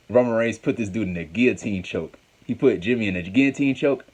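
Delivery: ordinary talk, nothing marked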